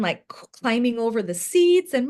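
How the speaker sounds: ordinary talk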